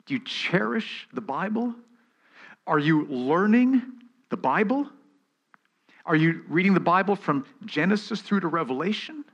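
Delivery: high, loud, pressing questions to the congregation; fervent preaching. Acted. Pastor challenging the congregation, fervent preaching. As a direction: preach it out, challenging every listener.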